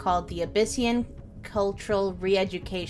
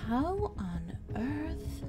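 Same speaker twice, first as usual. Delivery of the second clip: awed; 5 semitones higher, 7 dB quieter, much slower than usual. Acted. Chinchilla character reacts in awe.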